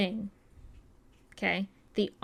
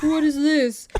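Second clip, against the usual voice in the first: raised, loud imitation of an angry man; mock threatening demand; 6 semitones higher, 8 dB louder than usual. Retelling a man threatening the girl, mock angry demand. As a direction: play at menacing, louder and higher. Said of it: "threatening her".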